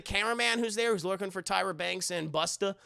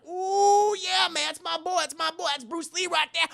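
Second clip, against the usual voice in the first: fanboy squeal about his hero; 11 semitones higher, 5 dB louder than usual. Retold or imitated. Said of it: "talking to himself".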